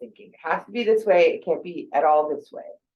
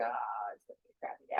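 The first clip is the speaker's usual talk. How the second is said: low, much softer, fast morning grumble; grumpy groan; 10 semitones lower, 16 dB quieter, faster than usual. Retold or imitated. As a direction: grumble it half-awake. Grumpy morning self quoted.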